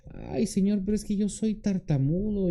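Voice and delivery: high, plaintive excuse-maker; Moses making excuses. Acted. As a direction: whine an excuse with a long "Ay". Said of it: "aquel que pone pretextos como Moisés".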